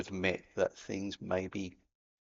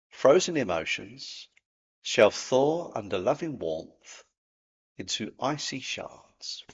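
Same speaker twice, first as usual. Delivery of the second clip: slow, weighty recitation of Frozen emotions; slow reading; 4 dB louder, slower than usual. Shown as a demonstration. Host rereading the day's quote to viewers, slow and solemn. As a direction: let each phrase land slowly, like a verse. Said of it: "I'll read that out one more time".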